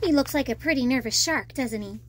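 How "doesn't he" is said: usual speaking voice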